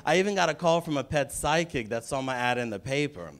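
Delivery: ordinary talk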